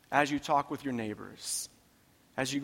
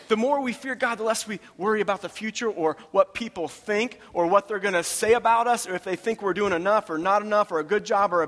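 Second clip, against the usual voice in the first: higher, driving preacher's refrain; full-force preaching; 6 semitones higher, 6 dB louder than usual. Real, not acted. Preacher urging the congregation to fear God not life, full-force preaching. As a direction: preach each repetition high and insistent.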